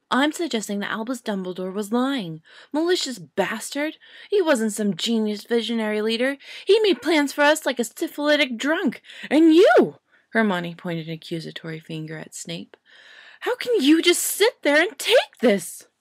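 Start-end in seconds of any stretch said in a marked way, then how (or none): 0.1-10.0 high, loud ranting Hermione; indignant witch losing her temper; furious young witch. Acted. 13.4-15.9 high, loud ranting Hermione; indignant witch losing her temper; furious young witch. Acted. Hermione accuses Snape.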